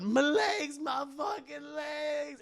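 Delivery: high, loud, slow cry of pain; agonized yell. Acted. Villain on the floor with shattered knees, agonized yell. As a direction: wail about the broken legs.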